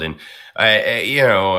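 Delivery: ordinary talk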